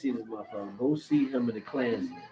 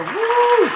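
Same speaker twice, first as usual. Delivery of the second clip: high, loud "woo"; excited whoop; 12 dB louder than usual. Real, not acted. Fan thrilled by a warrior women movie, whooping. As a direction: let out a piercing whoop. Whoops over The Woman King.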